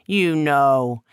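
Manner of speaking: a proud spirit showing off his home; trickster god Eshu. Acted. Eshu speaking, hurt.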